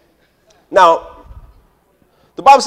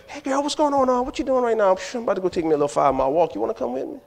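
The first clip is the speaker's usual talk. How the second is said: lightly voiced friend, high-pitched start, softer; chatty friend on the phone; 5 semitones higher, 7 dB quieter, faster than usual. Friend calling her girl for a walk, lightly acted chatty voice. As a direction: casually invite a pal along, hint of girlfriend chat.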